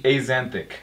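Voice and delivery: "axanthic" as ay-ZAN-thick; Z-sound pronunciation. Shown as a demonstration. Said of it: "it's the Z sound first".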